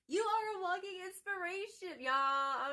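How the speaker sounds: suddenly high and loud, overcome with emotion; overwhelmed outburst. Real, not acted. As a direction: blurt it out as if the message hit you. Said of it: "I'm tripping".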